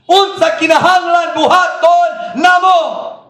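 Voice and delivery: loud, high, slow shouted plea; desperate crowd cry. Acted. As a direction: wail the question out at full voice. Repeats crowd's cry in Cebuano.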